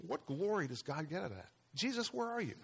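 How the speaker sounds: hushed, pleading lament; a soft, aching plea to Jesus; anguished cry to God. Retold or imitated. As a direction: cry out quietly in despair. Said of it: "and I'm saying".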